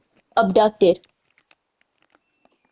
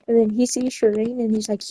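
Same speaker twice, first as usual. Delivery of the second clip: ordinary talk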